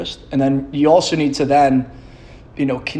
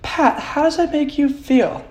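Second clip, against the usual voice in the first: high, slow mock-gentle therapist; 12 semitones higher, slower than usual. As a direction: parody therapist questioning the patient. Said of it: "Doing, like, a psychotherapy".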